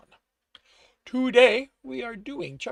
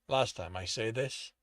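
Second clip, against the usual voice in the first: low, controlled final order; calm warning; 9 semitones lower, slower than usual. A narrator's reading.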